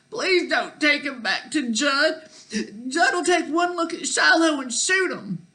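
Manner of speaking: put-on high, urgent child's begging; tearful plea. A narrator's reading. Child begs Dad for the hurt dog, tearful plea. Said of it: "He can probably tell I'm crying".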